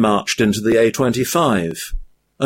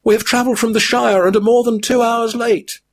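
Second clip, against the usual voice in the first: high weary hobbit voice; a high-pitched hobbit worn out by his burden; weary Frodo; 13 semitones higher, faster than usual. A narrator's reading. Frodo's held figure voice.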